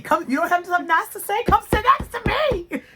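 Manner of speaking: put-on high, fast, sing-song little girl voice; chirpy kid inviting her over; imitating little girl. Little girl mocking bestie, high and fast.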